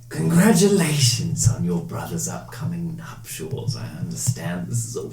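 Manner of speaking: voice in the mind. Acted. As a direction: intone it high and unhurried, like a voice inside someone's head. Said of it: "the voice echo in your mind".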